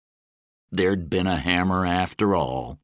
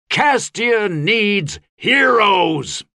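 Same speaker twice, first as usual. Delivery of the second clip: slow, high, loud rallying roar; 11 semitones higher, 5 dB louder, much slower than usual. A narrator's reading. Gabe roaring to the cheering bands, loud rally. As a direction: roar it out to an army. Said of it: "he roared".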